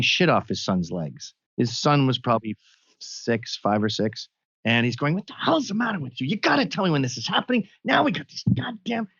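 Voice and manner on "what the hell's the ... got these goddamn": mock scolding father, pitched up high; retold angry father. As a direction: imitate a dad barking at his kid in an airport stall. Father scolding son in stall.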